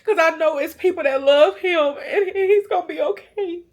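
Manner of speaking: high, tear-choked reassurance; tearful pleading. Retold or imitated. Mother praying about who will love her son, tearful pleading. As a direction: push the words out through tears.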